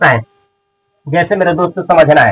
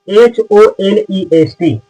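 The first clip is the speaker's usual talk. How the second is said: slow letter-by-letter spelling of H O N E S T; spelled out; slower than usual. Shown as a demonstration. Teacher spelling a word for students, slow separate letters.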